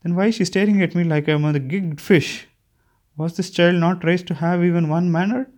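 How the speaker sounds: high-pitched, haughty complaint; imperious old lady. A narrator's reading. Confused Birchie complaining about the staring child, imperiously. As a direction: pitch it up in lofty disapproval. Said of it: "imperious voice".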